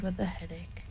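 a hushed storyteller's whisper; whispered aside. A narrator's reading. Narrator drops to a whisper.

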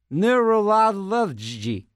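neurology drawn out syllable by syllable, very slow; slow careful try. Shown as a demonstration. DJ struggling to say a hard word, slow careful try. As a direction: sound out neurology slowly, each syllable apart. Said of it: "Can't say neurolo".

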